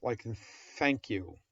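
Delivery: normal talking voice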